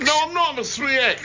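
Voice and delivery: high-pitched insistence; indignant protest. Real, not acted. Presenter insisting on his T-shirt size, indignant.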